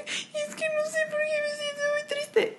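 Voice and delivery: put-on high, soft, weepy little-girl voice; little girl crying. Imitating herself crying like a little girl to her therapist. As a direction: cry it out like a small child. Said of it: "te pones como niña chiquita".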